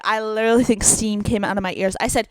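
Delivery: ordinary talk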